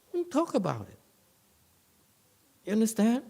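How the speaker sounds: high, firm command; sharp high don't talk about it; emphatic command. Real, not acted. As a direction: tell them flatly not to brag.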